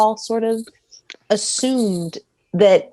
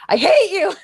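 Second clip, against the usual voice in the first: mock Rey; 11 semitones higher than usual. Rey snapping at Ben, retold.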